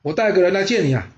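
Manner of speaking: long drawn calls to a far-off sworn brother; calling from afar. A narrator's reading. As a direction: shout out to a friend far off. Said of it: "远远的大叫".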